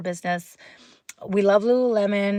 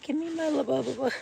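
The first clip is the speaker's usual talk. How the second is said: higher, softer pretend toy voice; indignant Labubu doll; 6 semitones higher, 5 dB quieter, faster than usual. Labubu doll asking for its bow back, small insistent voice. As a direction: pipe up as a demanding little doll. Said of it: "She's like".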